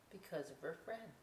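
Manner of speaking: plain reading voice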